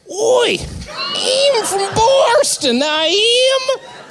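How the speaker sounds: high, slow, shouted fake Boston accent; mock Boston accent. Asked for his Boston accent, shouted high and slow. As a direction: yell it in a ridiculous Boston accent. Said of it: "you want me to do my Boston accent".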